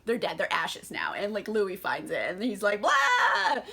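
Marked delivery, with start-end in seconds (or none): 2.8-3.6 high imitated wail; mock freak-out yell. Louis finding the ashes, mock freak-out yell. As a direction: cry out in comic meltdown. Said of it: "He loses his ever-loving shit".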